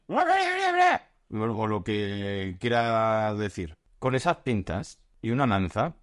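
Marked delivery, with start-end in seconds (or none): 0.1-1.4 a sudden very high squeak; imitated screech. Imitating the ghost screeching at him, sharp high squeak. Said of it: "Chillándome".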